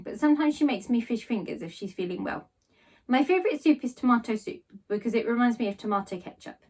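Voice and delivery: plain reading voice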